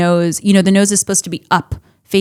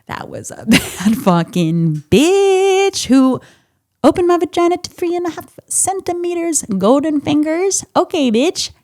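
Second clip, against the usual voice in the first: giddy amazed quote; 8 semitones higher than usual. Retold or imitated. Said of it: "I was like".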